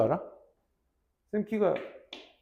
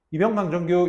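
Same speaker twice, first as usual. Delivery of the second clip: "이병, 강, 정, 규" rattled off in one breath with no gaps; wrong way, run together; 4 semitones higher, 6 dB louder than usual. Shown as a demonstration. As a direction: rush "강 정 규" together like one word. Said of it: "이러면 안 돼". (틈 없이 붙여서 말하는 잘못된 관등성명)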